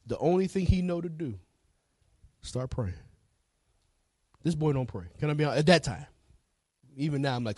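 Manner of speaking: normal talking voice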